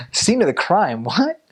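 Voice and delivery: amused disbelief, rising high at the end; incredulous echo. Retold or imitated. Missionary reacting to the cops' phrase, incredulous echo. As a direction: question the phrase in mock bafflement.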